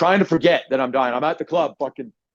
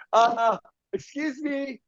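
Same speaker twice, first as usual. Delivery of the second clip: mock polite; 10 semitones higher, 3 dB quieter, much slower than usual. Mock polite complaint to waitress.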